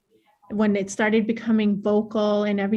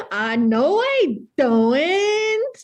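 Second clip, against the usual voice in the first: put-on high, very drawn-out northern accent; swooping, slow exaggerated northern accent; 7 semitones higher, 4 dB louder, much slower than usual. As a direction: protest in a hammed-up northern accent, vowels stretched. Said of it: "I had an accent".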